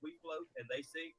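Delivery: quiet solemn. Acted. Eulogy-style mock speech.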